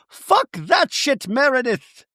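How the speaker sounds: high, slow mock fury; acted outrage. Accuser curses at Meredith, hammed-up outrage. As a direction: punch each word high and slow.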